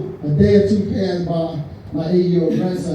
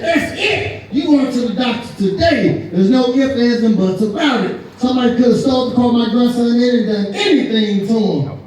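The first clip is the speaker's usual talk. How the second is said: retold angry outburst, pitched up; hitting the ceiling; 5 semitones higher, 3 dB louder than usual. Wife scolds husband for forgetting their grandson, acted angry outburst. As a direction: act out a wife laying down the law. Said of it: "She hit the ceiling".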